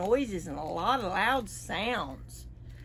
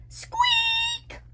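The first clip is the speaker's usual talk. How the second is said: mouse squeak. Acted.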